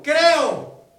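loud high shout of "Creo"; the father shouting his belief; crying out. Acted. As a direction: cry it out desperately. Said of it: "gritó y dijo".

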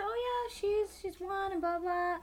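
airy polite brush-off; lightly sing-song and soft; high, breezy fake politeness. Retold polite reply.

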